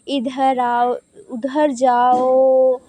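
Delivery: इधर आओ, उधर जाओ with the final vowels stretched very slowly; drawn-out calling. Shown as a demonstration. Teacher repeating a pluta call for students, stretched. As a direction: call out slowly, holding each last vowel. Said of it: "ऐसे हमने बोला".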